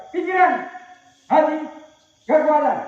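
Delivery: loud high command calls; game leader calls out the commands; called commands. Real, not acted. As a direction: bark each command to the group.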